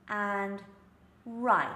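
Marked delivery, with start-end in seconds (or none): 1.2-1.7 "right" with rounded lips and the tongue curled back; clear R. Shown as a demonstration. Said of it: "that's the R".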